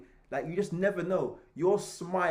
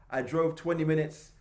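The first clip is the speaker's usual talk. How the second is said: ordinary talk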